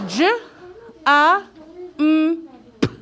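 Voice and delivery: each sound of J, A, M, P said singly with gaps. Shown as a demonstration. Teacher helping class decode a word, sounding it out. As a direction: sound out J, A, M, P one by one. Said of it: "Let's sound out that third word".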